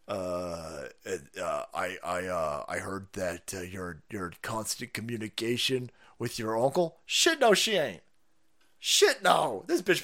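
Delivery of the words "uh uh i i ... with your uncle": halting interviewer. Retold or imitated.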